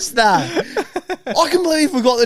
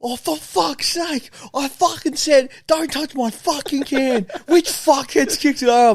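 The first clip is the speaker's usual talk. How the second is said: angry rant; 6 semitones higher than usual. Retold or imitated. Retelling a mate's rant over the spilled can, angry and raised. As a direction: mimic the mate fuming over his spilled drink.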